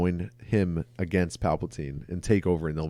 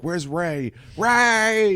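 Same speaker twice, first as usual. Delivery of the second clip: imitating a frantic high-pitched call; yelling her name; 10 semitones higher, much slower than usual. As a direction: holler out for a missing friend. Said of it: "he's always yelling her name".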